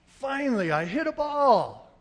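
loud, high, elated shout; exultant triumph. Retold or imitated. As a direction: crow over the hit at last. Reliving his first hit.

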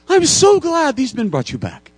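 put-on high, loud, overjoyed old priest; exaggerated high delighted welcome; gushing priest. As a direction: greet him like a long-lost son. Priest greets returned thief.

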